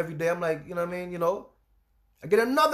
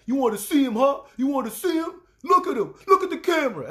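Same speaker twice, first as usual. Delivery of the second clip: loud, higher taunting menace; husband taunting; 6 semitones higher, 6 dB louder than usual. Retold or imitated. Husband taunting his crying wife on webcam, loud and menacing. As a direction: goad her loudly to look. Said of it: "He's like".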